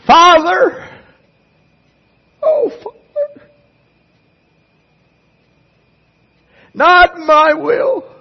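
high, loud crying-out of Father, slowed; anguished prayer. Acted. Jesus pleads in the garden, crying out in anguish. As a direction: cry out to the Father in agony.

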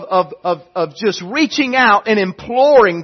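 ordinary delivery

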